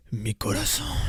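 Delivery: soft, low sigh of romance; telenovela heartthrob. Acted. Novela lover up close to her face, soft and smoldering. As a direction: purr it low and softly to a lover. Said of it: "he's just like".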